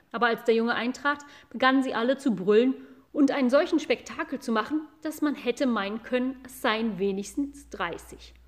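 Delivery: ordinary delivery, nothing marked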